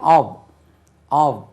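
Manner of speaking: "of" ending in a v sound, "ov"; teacher demonstrates "of" with voiced v; correct "of". Shown as a demonstration. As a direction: say "of" as "ov", not "off". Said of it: "V மாதிரி உச்சரி".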